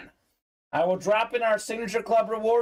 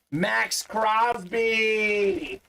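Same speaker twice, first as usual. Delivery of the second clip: announcer call; much slower than usual. Acted. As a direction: stretch the name like a ring announcer. Announcing a hit player.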